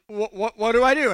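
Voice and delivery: stuttering mock panic; acted-out nervous stammer; panicked stammer. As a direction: stumble over the first word as if panicking. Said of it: "I'm kind of panicked".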